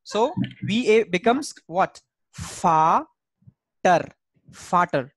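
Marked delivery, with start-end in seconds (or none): 2.3-5.1 "Fa-ter" split in two, then "Vater" joined; syllable-by-syllable demo of "Vater"; German V as F. Shown as a demonstration. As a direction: say Vater with an F and long open A. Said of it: "So V sound is F sound".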